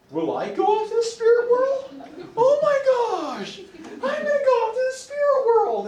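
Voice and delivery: put-on very high, loud, slow childlike panic; panicking child. Child terrified by talk of the spirit world, high loud panic. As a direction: wail it like a kid who just got scared. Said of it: "they're looking at you like".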